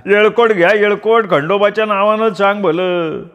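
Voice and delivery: high ringing ritual call; loud shout. A narrator's reading. Fiddler calling the crowd to Khandoba, a high ringing shout. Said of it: "मोठ्यानं ओरडला".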